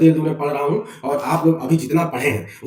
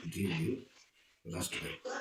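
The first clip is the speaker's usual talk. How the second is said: fading quote; 11 semitones lower, 21 dB quieter, much slower than usual. Shown as a demonstration. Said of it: "उठा लो".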